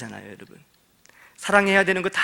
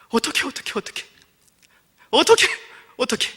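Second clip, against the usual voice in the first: panicked cry; 9 semitones higher, 4 dB louder than usual. Retold or imitated. Elder panics after the killing.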